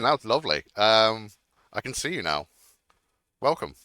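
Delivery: ordinary talk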